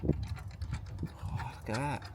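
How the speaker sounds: ordinary talk, nothing marked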